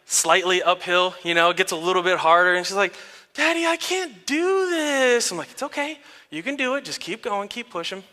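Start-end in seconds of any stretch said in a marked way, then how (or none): 3.3-5.3 put-on high, long-drawn childish wail; whining child. Daughter struggling uphill on her bike, imitated whine. 5.5-8.0 hushed, soothing parent voice; soft encouragement. Retold or imitated. Dad urging daughter up the hill, soft encouragement.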